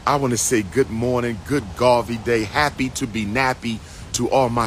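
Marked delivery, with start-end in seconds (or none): none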